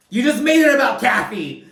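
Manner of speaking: high, loud, incredulous outburst; exasperated shout. Retold or imitated. Kyle hurling it back at Sutton, exasperated shout. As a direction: fire the accusation right back, loud and high.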